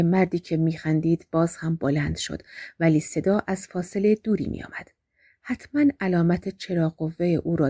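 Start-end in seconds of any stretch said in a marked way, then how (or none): none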